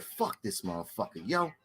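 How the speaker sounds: deep quiet mock grumble; mimicking Fred. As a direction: grumble it under the breath. Fred voiced in retold story.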